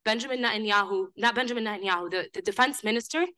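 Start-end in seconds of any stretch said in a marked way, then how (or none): none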